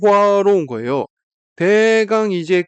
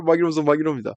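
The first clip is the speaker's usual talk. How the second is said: usual speaking voice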